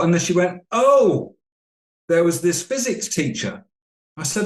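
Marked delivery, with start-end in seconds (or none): 0.7-3.6 a higher, sudden "oh" of remembering; lightly voiced client, higher and startled; sudden recollection. Client suddenly recalls teacher.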